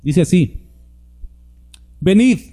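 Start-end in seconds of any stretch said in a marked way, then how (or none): 2.0-2.4 calling out. Shown as a demonstration. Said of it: "Es una invitación".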